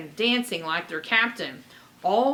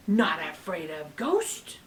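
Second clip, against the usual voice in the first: booming ghost; 4 semitones lower than usual. Acted. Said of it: "his voice boomed".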